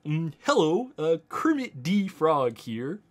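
slow, hesitant frog puppet voice; Kermit the Frog. Shown as a demonstration. Streamer testing his calibration for a little bit of Kermit, rough impression. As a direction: attempt a shaky-aim cartoon frog, slowly. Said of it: "I can kinda do a little bit of Kermit".

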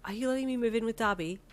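high, hopeful question; excited disbelief; astonished. A narrator's reading. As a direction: ask in amazed hope. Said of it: "you gasped, your eyes widening".

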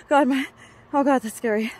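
usual speaking voice

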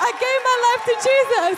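high, very loud cry of victory; triumphant shout. Retold or imitated. Preacher repeats the convert's news to the congregation, triumphant shout. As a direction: shout the news to the whole room.

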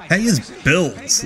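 put-on a light southern accent copying the contestant; mock southern accent. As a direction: echo her in a lazy southern accent. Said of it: "She tried to do a southern accent there".

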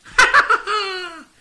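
high, loud cackling laugh; hearty witch laugh; witch's laugh. A narrator's reading. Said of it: "The witch laughed out loud".

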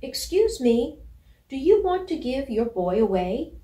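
high, loud polite shout; loud polite call. Acted. Puppy calling loudly up to a bulldog in a car, polite. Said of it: "called out loudly, but very politely".

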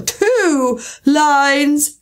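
high, put-on creature cry. A narrator's reading. Mock Turtle corrects the Gryphon, crying out. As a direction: call it out high and excited. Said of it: "cried the Mock Turtles".

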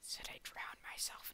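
hushed unvoiced whisper to himself. Real, not acted. Gamer whispering a dark joke to himself, hushed whisper. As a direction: breathe it out as a secret aside.

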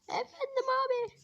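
put-on kid voice. The kid answering the grown-up, in a high child voice. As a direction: play a cheeky little boy.

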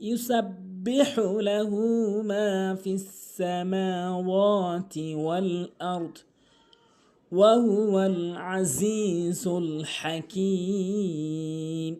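slow, drawn-out chanted recitation; Quran recitation. Shown as a demonstration. Teacher reciting the verse slowly for students.

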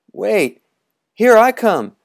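put-on high, loud boy's cry; running boy calls out, high and loud. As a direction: call out as you run to jump. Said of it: "cried Beggar".

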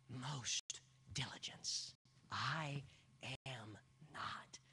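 quiet, intimate sermon narration; hushed storytelling. Acted. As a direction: draw the room in close. Hushed retelling of denial.

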